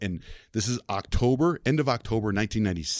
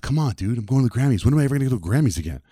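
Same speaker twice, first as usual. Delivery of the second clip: higher-pitched, hurried plea; pleading protest; 5 semitones higher, 4 dB louder, faster than usual. Retold or imitated. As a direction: plead with the boss in a retold protest. Pleading with boss to go.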